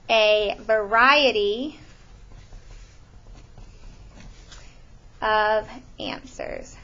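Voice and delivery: stretched out, very slow; emphatic slow dictation. Shown as a demonstration. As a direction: draw out the key phrase while writing it. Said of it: "I'm gonna underline that word variety".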